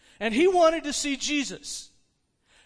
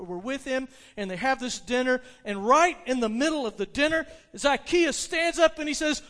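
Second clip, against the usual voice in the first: ordinary talk, nothing marked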